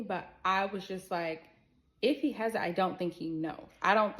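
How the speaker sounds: ordinary delivery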